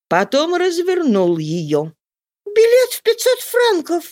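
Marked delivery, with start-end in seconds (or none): 2.5-4.0 very high, amazed woman's cry; astonished exclamation. A narrator's reading. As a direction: gasp it out in amazement. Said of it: "воскликнула Роза изумлённо".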